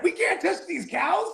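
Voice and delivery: high, laughing mock voice. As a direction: squeak it out like a cartoon. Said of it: "He's like".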